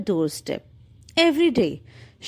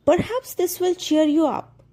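gentle; 8 semitones higher than usual. A narrator's reading. Anna comforts the holly tree.

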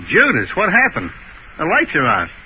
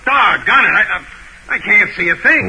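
flustered shouting; 11 semitones higher, 4 dB louder than usual. Acted. Fumbling in the dark, flustered.

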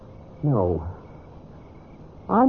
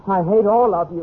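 higher and louder, lashing out at everyone; furious outburst; 8 semitones higher, 5 dB louder than usual. Acted. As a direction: explode with hatred. Bitter quarrel with her husband.

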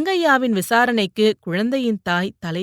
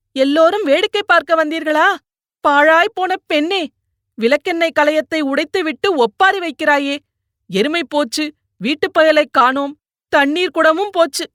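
high-pitched, raised-voice wailing complaint; loud lamenting; 8 semitones higher, 5 dB louder than usual. A narrator's reading. Fallen mother berating daughter before neighbours, loud high lament. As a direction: scold and bewail every loss at high pitch. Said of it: "என்று பிரலாபம் தொடங்கிவிட்டாள் அவள்".

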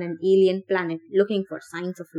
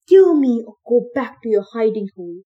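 inner scream; 3 semitones higher, 8 dB louder than usual. Acted. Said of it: "He wanted to scream at the monster".

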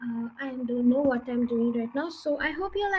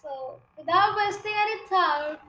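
ordinary delivery, nothing marked

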